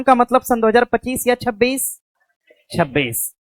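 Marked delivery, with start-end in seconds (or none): none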